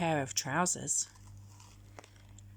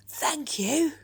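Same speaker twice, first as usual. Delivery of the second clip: higher, louder goat thanks; a grateful bleating goat; 8 semitones higher, 4 dB louder than usual. Acted. Goat thanking George for the new sail, high and bright. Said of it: "said the goat".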